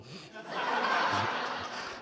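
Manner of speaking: a quiet airy chuckle; soft breathy laugh. Real, not acted. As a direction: laugh softly at the memory. Laughs at the memory.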